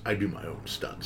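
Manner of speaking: low, soft, deadpan comeback; smug villain quote. Retold or imitated. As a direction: toss off the line like a cocky film villain. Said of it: "he looks over and just goes".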